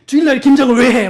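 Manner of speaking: hammed-up indignant busybody; mock outraged scolding. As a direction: scold like a shocked church busybody. Zealot scolding neighbor.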